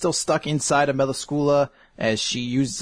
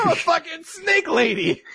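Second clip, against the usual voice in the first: much higher put-on voice; hammed-up villain line; mock snake lady. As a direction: brag about being a snake. S0 continues the snake voice.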